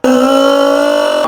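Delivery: mock a teacher by dragging out "Euh" as one endless trill; endlessly rolled R. Student mocks the Spanish teacher's accent, endlessly rolled R. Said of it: "qui roule des airs sur des kilomètres".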